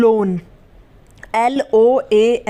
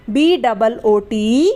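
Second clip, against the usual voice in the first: slow letter-by-letter spelling; slower than usual. Shown as a demonstration. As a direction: spell बी डबल ओ टी out slowly, letter by letter. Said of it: "spelling out the word". (धीरे-धीरे एक-एक अक्षर बोलकर spelling)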